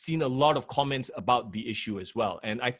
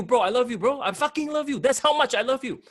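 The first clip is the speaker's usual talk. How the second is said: gushing affection; 9 semitones higher than usual. Shown as a demonstration. Host acts out loving swearing for co-host, high gushing voice. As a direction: gush "I fucking love you" like hugging your best bro. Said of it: "I'm telling you".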